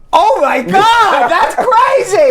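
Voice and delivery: high, loud, drawn-out disbelief; mock astonishment. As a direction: act out someone stunned by breaking news. Acted shocked reaction to the news.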